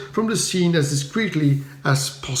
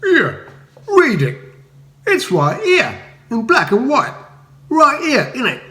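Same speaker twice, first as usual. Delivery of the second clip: high, loud cockney insistence; Pearly King insisting furiously; 10 semitones higher, 4 dB louder than usual. A narrator's reading. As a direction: shove the pamphlet in his face. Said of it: "clearly furious".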